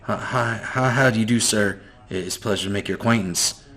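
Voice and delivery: soft, hurried, over-polite greeting; timid boy. Acted. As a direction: rush the courtesies, scared to offend.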